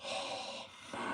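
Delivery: whispered oh man in dismay; whispered horror. Retold or imitated. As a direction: breathe it out in defeat. Dad's horror at spider returning.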